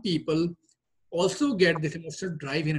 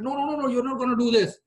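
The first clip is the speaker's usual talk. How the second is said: control freak boss; 8 semitones higher, 3 dB louder, faster than usual. Retold or imitated. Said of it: "high on control and security".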